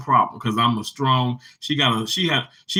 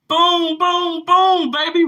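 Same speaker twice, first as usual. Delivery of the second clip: high, loud and bouncy; a real burst of excitement, much higher and louder; hyped-up cheer; 6 dB louder, slower than usual. Real, not acted. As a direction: pump up the live chat. Hype cheer on the live stream.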